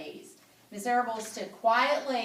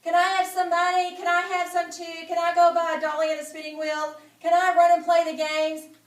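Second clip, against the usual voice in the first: much higher, loud, fast pleading; eager kids begging; 8 semitones higher, 9 dB louder, faster than usual. Acted. Fern and Avery begging their parents for money, high and eager. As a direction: beg like excited little kids.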